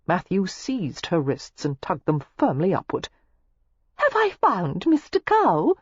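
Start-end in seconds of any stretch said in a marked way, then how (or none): 3.9-5.7 high, falsely innocent question; disingenuous. A narrator's reading. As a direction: feign innocence while flirting. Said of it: "she asked disingenuously".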